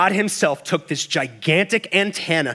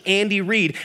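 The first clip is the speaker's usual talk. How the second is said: ordinary talk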